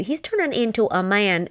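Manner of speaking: ordinary delivery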